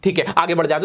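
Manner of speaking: ordinary talk, nothing marked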